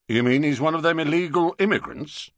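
quick London bobby; london policeman. Acted. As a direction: rattle it off like a suspicious copper. Policeman voiced in story.

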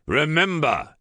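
loud commanding call; rally them with a shout. A narrator's reading. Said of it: "he shouted".

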